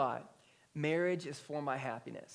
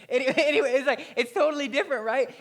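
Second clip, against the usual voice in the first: loud, higher laughing through the words; 8 semitones higher, 11 dB louder, faster than usual. Real, not acted. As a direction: laugh it off and push on. Laughing at his own story.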